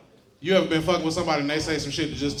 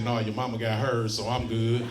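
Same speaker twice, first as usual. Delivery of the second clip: lightly voiced dad; 6 semitones lower than usual. Daddy's voice in story.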